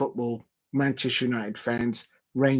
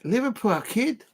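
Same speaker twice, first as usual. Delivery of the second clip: high, put-on Liverpool accent try; amateur Scouse impression, pitched up; attempted Scouse accent; 9 semitones higher than usual. Shown as a demonstration. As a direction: have a go at a Scouser voice. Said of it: "I can do".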